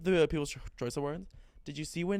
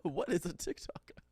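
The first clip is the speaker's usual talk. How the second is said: light Australian accent imitation; 4 semitones higher, 3 dB quieter than usual. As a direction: ask it like an Australian pop star. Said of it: "In her Australian accent".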